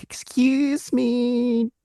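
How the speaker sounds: very high, very slow mock yell; imitating a scream. Learner retelling a skier out of control, mock yell. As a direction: reproduce a skier's high, stretched cry. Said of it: "she was screaming".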